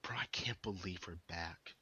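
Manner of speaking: awed whisper to himself. Real, not acted. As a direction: breathe it out in disbelief. Reacting as episode begins.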